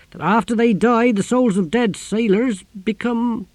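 high, loud, slowing to a halt at the end; chatty sea captain. A narrator's reading. Captain telling the Exquisitor a sailors' saying, eager then faltering.

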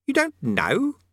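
put-on high, young boy asking; boy's voice. A narrator's reading. Eric, a boy, questions Rincewind.

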